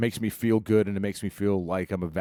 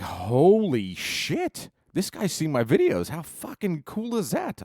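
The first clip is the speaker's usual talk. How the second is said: rising higher and higher, giddy with excitement; thrilled disbelief; 8 semitones higher, 3 dB louder, slower than usual. Retold or imitated. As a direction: relive the moment the famous guitarist wrote back, starstruck. Retold thrill at [name]'s message.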